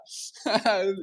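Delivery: high, breathy burst of laughter; laughing while retelling a friend's prank; real laugh. Real, not acted.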